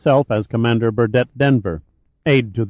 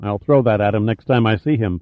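usual speaking voice

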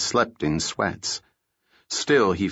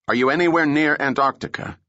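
sharp accusatory; 6 semitones higher, 3 dB louder, faster than usual. A narrator's reading. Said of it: "The tone was sharp, accusatory".

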